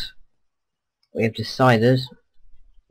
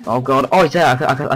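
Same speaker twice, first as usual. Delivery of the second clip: alarmed; 4 semitones higher, 6 dB louder than usual. Real, not acted. Spots the enemy sniper.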